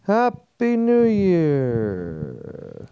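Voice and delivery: high, slow celebratory call; high, stretched cheer for the toast; drawn-out toast. Acted. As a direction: sing out the toast.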